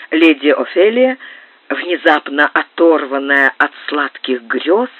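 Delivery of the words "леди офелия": usual speaking voice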